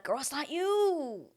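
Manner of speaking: put-on high, drawn-out girlfriend plea; pleading reassurance. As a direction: console her with exaggerated insistence. Consoling her in imagined reply.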